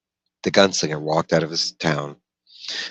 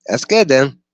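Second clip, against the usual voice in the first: high, loud raised voice for the dweller; Brown's voice; 9 semitones higher, 8 dB louder than usual. Acted. Dweller Brown questioning the gunslinger by the fire, high and loud. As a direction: pitch him well up and push it out.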